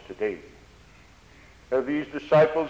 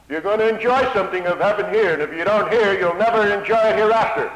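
building preacher's shout; 7 semitones higher, 7 dB louder than usual. Real, not acted. Preacher warning his hearers, climaxing.

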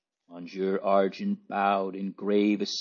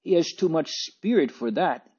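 high-pitched indignant wife; mildly acted indignant retort; 7 semitones higher than usual. A narrator's reading. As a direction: object indignantly, pitch lifted. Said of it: "cried the good wife indignantly".

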